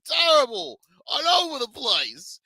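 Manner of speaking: high, slow exaggerated disdain; mock outrage. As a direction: stretch it out like a theatrical complaint. Mocking exaggeration continues.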